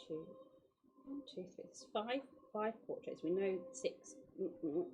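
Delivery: usual speaking voice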